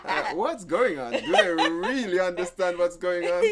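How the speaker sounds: mock the couple's question while cracking up; laughing. Pastor laughing as he quotes the troubled couple.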